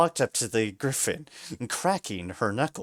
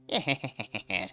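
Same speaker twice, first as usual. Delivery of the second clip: sly villain laugh; wicked chuckle. A narrator's reading. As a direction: chuckle with malice. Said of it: "gave a wicked chuckle".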